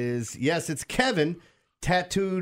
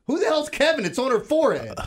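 higher, louder disbelieving cry; incredulous outburst; 10 semitones higher, 5 dB louder than usual. Real, not acted. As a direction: blurt it out in mock outrage. Mocking tattoo absurdity.

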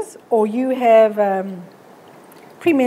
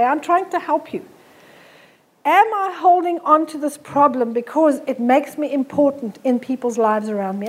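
ordinary talk

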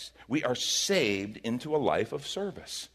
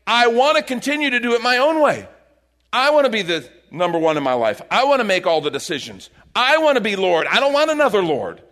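loud, insistent voice of the selfish self; demanding shout; 6 semitones higher, 8 dB louder than usual. Retold or imitated. Preacher voicing a self-ruled heart, loud and demanding. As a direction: belt out the demands of someone who wants to be Lord.

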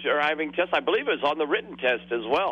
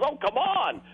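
much higher, whiny begging; pleading teen; 11 semitones higher than usual. Retold or imitated. Teen begging the examiner not to fail him, high and whiny. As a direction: plead like a scared kid.

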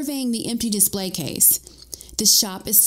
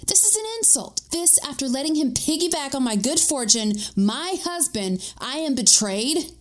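high-pitched theatrical indignation; wounded outrage, pitched up; betrayed and affronted, played up; indignant; 6 semitones higher than usual. Narrator acts out her outrage.

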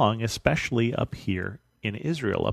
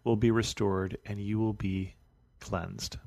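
soft, even Bible reading, a little quieter than his talk; scripture reading; 6 dB quieter than usual. Acted. Pastor reading the Naaman story to his congregation, steady soft reading voice. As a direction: read the passage out steadily and softly.

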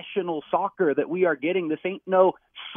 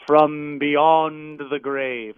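spooky afterlife voice. Pretend. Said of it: "Nate Abaurrea from the grave".

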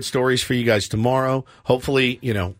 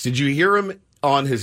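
ordinary talk

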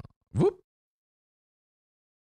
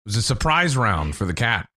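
normal talking voice

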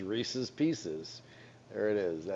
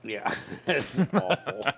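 laughing. Real, not acted. Laughs at Good and Plenty.

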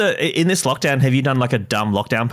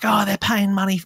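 higher-pitched comedian impression, only half started; Dave Hughes; 9 semitones higher than usual. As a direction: lightly mimic a ranting comic. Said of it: "he's doing, obviously, his voice".

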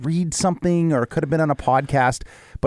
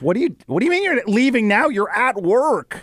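incredulous boss; 12 semitones higher than usual. Retold or imitated. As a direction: stretch it out in mock disbelief.

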